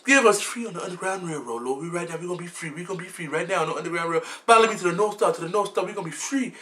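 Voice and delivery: soft, hushed mock slave call; lightly voiced Harriet Tubman leading slaves north. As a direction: whisper the escape plan. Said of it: "bring back Harriet Tubman".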